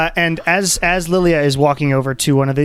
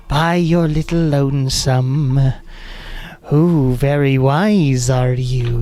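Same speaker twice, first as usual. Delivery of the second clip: slow, drawn-out eerie woman; creepy lady voice; 2 semitones higher, much slower than usual. Acted. Eerie glade voice greets a lone visitor, creepy lady voice. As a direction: draw each word out, creepy and ladylike. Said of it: "creepily being the creepy voice".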